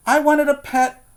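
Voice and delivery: put-on high, loud wishful boy voice; longing child. Boy telling about his dream pet, high and yearning. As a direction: cry out what he really wanted.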